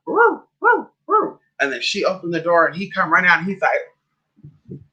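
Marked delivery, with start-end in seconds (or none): 0.1-1.4 high-pitched mock dog woofs; imitated dog bark. Telling how the client's dog greeted her at the door, imitated bark. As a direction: woof like an excited little dog.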